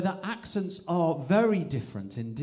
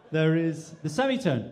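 ordinary talk

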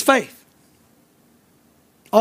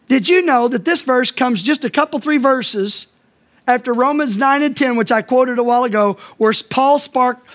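normal talking voice

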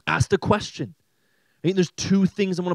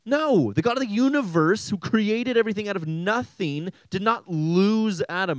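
usual speaking voice

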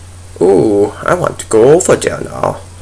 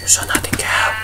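softer, sinister whisper to a witness; menacing whisper; 6 semitones higher, 12 dB quieter, faster than usual. Pretend. Gamer threatens a cow witness in a sinister whisper.

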